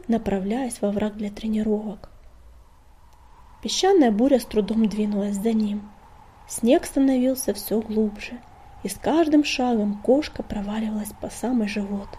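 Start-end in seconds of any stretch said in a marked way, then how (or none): none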